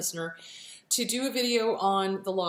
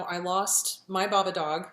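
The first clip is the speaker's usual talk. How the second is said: usual speaking voice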